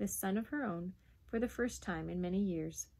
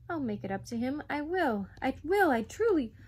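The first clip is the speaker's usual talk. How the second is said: put-on rising, louder boyish promises; earnest pleading; 5 semitones higher, 6 dB louder than usual. Boy promises mother he will repay it, pleading. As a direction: insist harder with each promise.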